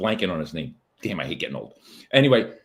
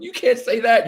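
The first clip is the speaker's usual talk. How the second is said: higher, louder mock scold; mock outrage; 12 semitones higher, 6 dB louder than usual. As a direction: scold like an offended critic. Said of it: "both sides going".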